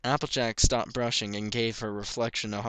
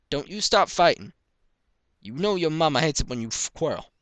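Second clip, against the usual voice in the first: higher, louder fatherly scold; stern father; 6 semitones higher, 6 dB louder than usual. A narrator's reading. Pa scolding his quarreling kids, firm. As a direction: break up the squabble firmly.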